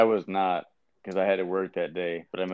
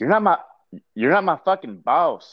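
loud, higher mock country accent; 7 semitones higher, 5 dB louder than usual. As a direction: sell it with a hammed-up country accent. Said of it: "in, like, a really country accent".

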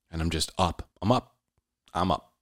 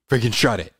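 ordinary delivery

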